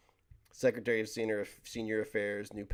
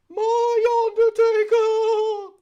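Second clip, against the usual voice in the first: high, slow, hugely loud play-by-play roar; commentator scream; 15 dB louder, much slower than usual. Acted. Streamer recreating a famous wrestling call, screamed at the top. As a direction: bellow it like a ringside announcer losing it.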